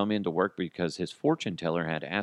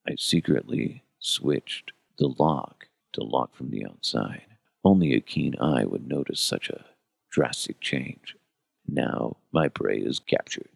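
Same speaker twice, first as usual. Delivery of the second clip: serial killer monologue; 10 semitones lower than usual. Retold or imitated. Host imitating a killer from his wife's show, low and sinister. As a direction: murmur it like a TV killer gloating over his prey. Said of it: "He's always doing these monologues".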